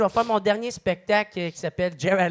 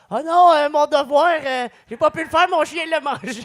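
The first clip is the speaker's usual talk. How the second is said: put-on high, loud pleading kid voice; whiny student excuse; 8 semitones higher, 6 dB louder than usual. Mimicking a student's lame excuse.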